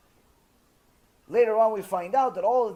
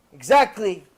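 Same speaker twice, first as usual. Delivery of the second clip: much louder, punched single word; emphatic confirmation; 10 dB louder than usual. Retold or imitated. Wife drives her lesson home to husband, emphatically. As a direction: hit it hard as proof of the point.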